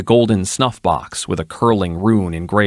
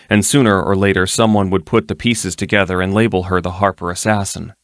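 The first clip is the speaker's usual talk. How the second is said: plain reading voice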